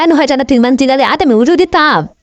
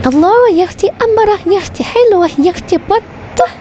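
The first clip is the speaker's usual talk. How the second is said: squeaky high cooing; rising high sing-song praise; high sugary pet voice; 7 semitones higher than usual. Acted. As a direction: coo it up high like a pampered pet. Cat voice praising the food.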